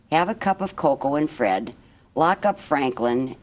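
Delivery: ordinary delivery, nothing marked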